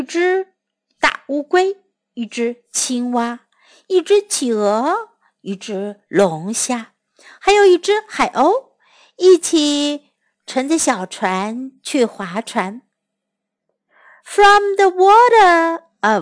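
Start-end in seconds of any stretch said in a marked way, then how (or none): none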